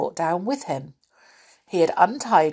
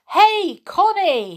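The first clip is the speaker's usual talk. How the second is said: high, loud hail; calling out; 14 semitones higher, 7 dB louder, slower than usual. A narrator's reading. Moonface spots Connie's feet above him, calling out. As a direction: call up to someone above. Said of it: "he called".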